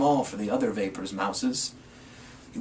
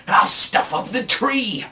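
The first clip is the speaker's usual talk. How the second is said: a sly, higher grinning Grinch line; 8 semitones higher, 7 dB louder, slower than usual. A narrator's reading. Grinch gloating to himself over the tree. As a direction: gloat it slowly with a grin. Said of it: "grinned the Grinch".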